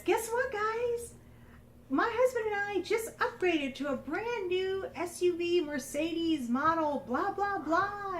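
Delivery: high, slow, sing-song imitation of a show-off; mock bubbly bragging. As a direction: gush about the new car like a smug friend. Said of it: "and she says".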